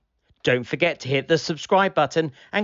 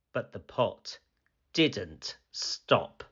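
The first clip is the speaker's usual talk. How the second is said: ordinary delivery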